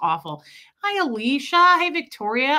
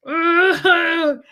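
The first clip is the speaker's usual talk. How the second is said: loud, high, drawn-out "ugh"; cringing groan; 6 semitones higher, 8 dB louder than usual. Acted. Streamer acting out a cringe moment for viewers, loud embarrassed groan. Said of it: "cringe. Do you guys ever have a moment where you're just like".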